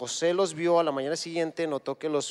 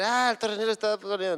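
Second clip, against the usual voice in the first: lightly voiced objecting listener, a touch higher; mock objector; 5 semitones higher, 4 dB louder than usual. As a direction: imitate a doubter scoffing at the preacher. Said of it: "luego van a decir".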